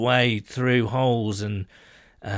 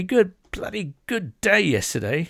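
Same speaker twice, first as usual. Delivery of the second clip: hammed-up British accent, pitched up on "bloody good day"; mock British accent; 7 semitones higher than usual. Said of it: "That was a great accent".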